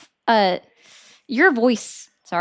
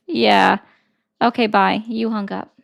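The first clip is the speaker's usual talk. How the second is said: normal talking voice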